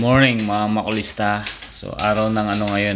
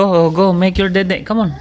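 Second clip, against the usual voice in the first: urging on; 9 semitones higher, 3 dB louder than usual. Real, not acted. Parent urging child to go.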